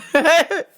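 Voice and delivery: short high laugh. Real, not acted. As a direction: crack up. Laughing at own story.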